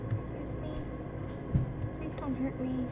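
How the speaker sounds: soft pleading. Real, not acted. Pleading with a scary character.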